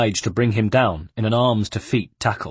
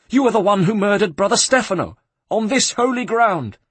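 high, loud denunciation; outraged accusation; 11 semitones higher, 4 dB louder than usual. A narrator's reading. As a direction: accuse him at the top of your range. Terrified abbot accusing Ezio.

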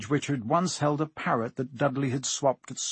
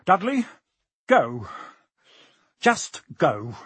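winded, broken-up order; short gasped phrases with gaps; out-of-breath uncle dismissing his son; wheezing; 4 semitones higher, slower than usual. A narrator's reading. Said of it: "he wheezed at Harry".

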